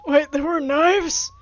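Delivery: loud, slow shocked disbelief. Real, not acted. Player shocked to find knives left out, loud and slow.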